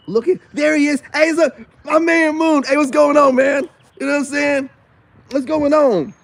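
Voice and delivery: hyped shout-out to friend Moon; excited greeting. Real, not acted. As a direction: call out to a friend happily. Greeting his friend Moon on camera.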